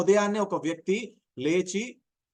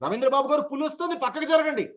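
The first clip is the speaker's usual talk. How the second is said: fast, much higher, urgent alarm; quick urgent warning; 8 semitones higher than usual. Shown as a demonstration. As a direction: warn him quickly, the tiger is coming. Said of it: "నేను తొందరగా మాట్లాడాలి". (వేగంగా, కంగారుగా హెచ్చరిక)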